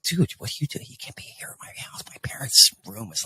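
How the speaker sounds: hushed, panicky whispered warning; urgent whisper. Retold or imitated. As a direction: whisper so the parents next door won't hear. Hushed warning near sleeping parents.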